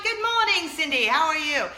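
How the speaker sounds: imitating a neighbor's loud, high British greeting; British accent. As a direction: mimic a cheery Brit calling hello, hammed up. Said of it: "I just love that he's British".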